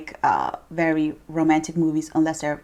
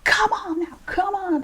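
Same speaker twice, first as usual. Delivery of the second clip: much higher, drawn-out cheering; annoying sideline mom; 14 semitones higher than usual. Retold or imitated. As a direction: yell encouragement at a kid's soccer game. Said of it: "that annoying mom".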